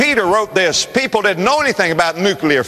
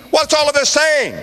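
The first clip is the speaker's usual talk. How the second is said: high preaching cry; 11 semitones higher than usual. Real, not acted. Preacher raising the challenge.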